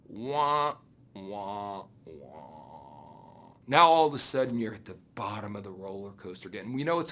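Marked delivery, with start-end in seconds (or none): 0.1-2.5 descending mock sad trombone sound; sad trombone wah. Speaker mocks the recruit's disappointment, descending wah wah. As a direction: imitate a falling letdown horn, fading to a whisper. Letdown after buddy backs out.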